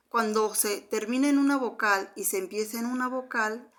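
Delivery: ordinary delivery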